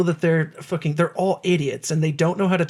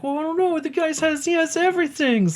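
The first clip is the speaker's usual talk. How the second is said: much higher, goofy band-member voice; high-pitched dopey voice; 11 semitones higher than usual. Retold or imitated. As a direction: squeak it out like a clueless rocker. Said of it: "And they're like".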